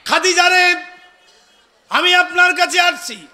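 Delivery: high, loud chanted exhortation; full-force preaching. Real, not acted. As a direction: draw out the calls and ring them out to the crowd.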